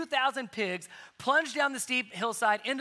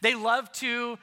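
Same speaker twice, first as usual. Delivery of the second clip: ordinary talk, nothing marked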